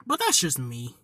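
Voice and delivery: normal talking voice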